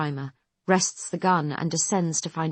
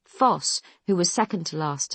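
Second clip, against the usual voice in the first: plain reading voice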